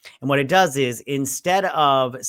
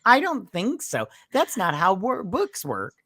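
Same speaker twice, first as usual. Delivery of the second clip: slow, higher sassy comeback; mock sassy put-down, slow and higher; sassy retort; 6 semitones higher, 4 dB louder, slower than usual. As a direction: drag it out with sass.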